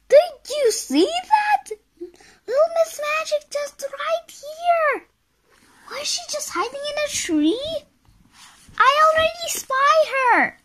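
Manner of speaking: very high, loud, rapid squeaky chatter; excited onlooker. Acted. Onlooker spots Miss Magic in the picture, high and excited.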